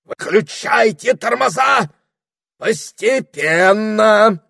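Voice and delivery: high squawking parrot call, slow; parrot shouting. A narrator's reading. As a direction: shout the orders like a shrill bird. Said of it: "провозгласил попугай".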